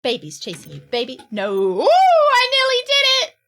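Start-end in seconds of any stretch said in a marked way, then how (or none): 1.5-3.4 high, loud excited cry; thrilled high yelp; excited relief. Real, not acted. Nearly escaping in game.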